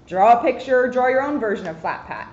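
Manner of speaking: usual speaking voice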